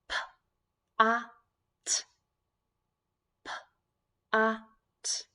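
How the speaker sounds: P, a, t as separate sounds; tapping out sounds. Shown as a demonstration. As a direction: tap out each sound. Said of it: "We'll tap out the sounds".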